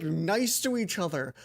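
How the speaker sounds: ordinary delivery, nothing marked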